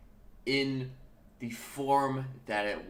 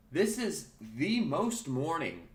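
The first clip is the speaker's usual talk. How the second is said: drawn out, raised pitch; slow emphatic declaration; 6 semitones higher, much slower than usual. Real, not acted. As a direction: stretch every word for emphasis. Hyping up the early show.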